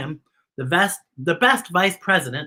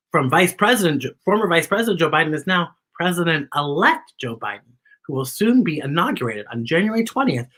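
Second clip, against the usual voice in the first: ordinary delivery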